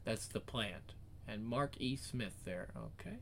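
ordinary delivery

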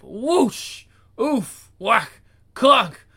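loud, punchy comic impacts; voiced fight sound effects. Reader voicing a ninja fight, punchy noises. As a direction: shout each noise like a cartoon blow.

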